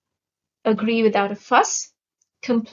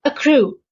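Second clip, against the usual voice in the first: "Accrue" with the second syllable stressed, louder; 3 semitones higher, 6 dB louder than usual. Shown as a demonstration. Teacher presenting a new vocabulary word, stress on second syllable of "Accrue". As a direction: say "Accrue" clearly, leaning on its last syllable. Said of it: "The stress is on the second syllable".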